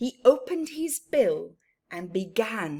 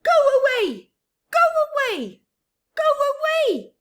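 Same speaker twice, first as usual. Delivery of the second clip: high, loud shrieking go away; go-away bird shouting; 13 dB louder, faster than usual. Acted. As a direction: shriek it at every visitor.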